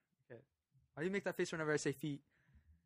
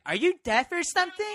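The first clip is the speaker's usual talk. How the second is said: much higher, louder mock outrage; angry bus driver; 12 semitones higher, 14 dB louder than usual. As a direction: snap at the kids like a furious driver. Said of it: "she's like".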